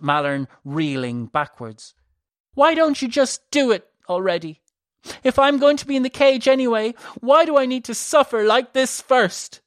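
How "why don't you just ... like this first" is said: high, loud anguished cry; desperate outburst. A narrator's reading. Elisa lashing out at her sister, anguished high cry. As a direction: throw it at her in despair.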